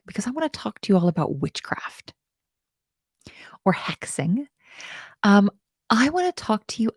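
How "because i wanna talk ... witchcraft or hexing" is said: conspiratorial whisper. Acted. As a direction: whisper it like a secret. Whispers about witchcraft.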